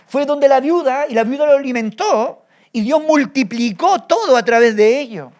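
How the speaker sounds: predicación enérgica y sonora, subiendo el tono; predicando con fuerza. Acted. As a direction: proclama como desde el púlpito. Predicación enfática sobre Elías. (predicando fuerte ante la congregación)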